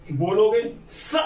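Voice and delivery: s drawn out slowly with teeth together; slow demo s sound. Shown as a demonstration. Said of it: "देखो स, दांत में दांत लग रहे हैं".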